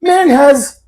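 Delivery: high, loud English mock interjection; imitated English accent. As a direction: cut in with a put-on English accent. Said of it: "I love your accent".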